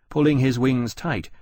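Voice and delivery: plain reading voice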